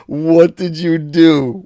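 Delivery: loud slow disbelief; stunned by a generous gift; surprised. Real, not acted. Second big super chat.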